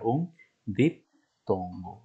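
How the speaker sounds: "diptongo" slowly split as dip, tongo; slow "un diptongo", broken into dip and tongo; split syllables. Shown as a demonstration. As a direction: hold a clear pause after "dip" in "diptongo". Teacher spells out term by syllable.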